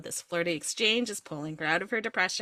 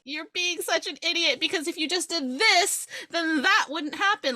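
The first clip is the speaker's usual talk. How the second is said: higher, fed-up scolding; exasperated scolding; 8 semitones higher than usual. Real, not acted. Reader scolds the heroine, higher and exasperated. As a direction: scold the character like she's being foolish.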